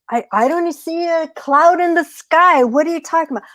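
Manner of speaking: high, incredulous scoff; skeptical coyote. Acted. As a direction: doubt every word. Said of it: "And the coyote's like".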